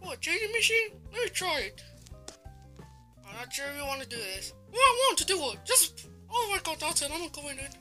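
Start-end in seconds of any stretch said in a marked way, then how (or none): 0.0-1.8 eager. Acted. Eager to try machine. 4.7-7.8 insistent. Acted. Insisting, excited.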